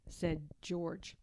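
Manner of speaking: ordinary delivery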